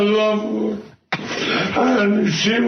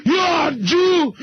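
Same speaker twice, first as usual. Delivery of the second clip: higher, shouted tirade with a sob cracking through; furious rant; 6 semitones higher than usual. Pretend. Yelling at Randy Savage over the phone, hammed-up fury. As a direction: scream it down the phone at an old rival.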